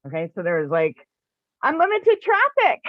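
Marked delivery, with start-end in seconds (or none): 1.6-2.8 high, loud excited exclamation; excited boast. Retold or imitated. Storyteller recalls the highway spot to listeners, excited boast. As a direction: sing out the big news loudly.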